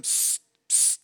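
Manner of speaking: short voiceless psst, twice; hissed psst. Acted. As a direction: get someone's attention with a sharp psst. Snake calls to farmer in story.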